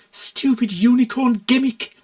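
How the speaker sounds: a talking bin grumbling. A narrator's reading. Enchanted bin complaining about the shredded poster.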